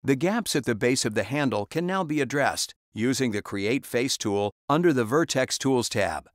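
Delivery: ordinary talk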